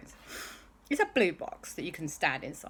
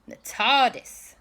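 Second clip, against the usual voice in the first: usual speaking voice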